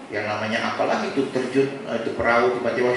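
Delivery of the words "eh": ordinary delivery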